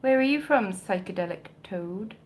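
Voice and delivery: ordinary talk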